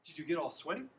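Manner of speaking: high, loud playful question; parent asking. A narrator's reading. Parents quiz their son at dinner, eager.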